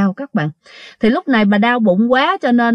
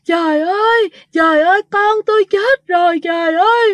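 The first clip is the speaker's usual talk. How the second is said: put-on ghostly mother sobbing over her dead child; wailing woman; 8 semitones higher than usual. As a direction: wail like a mother who lost her child. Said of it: "mà khóc, khóc rất là lớn nha".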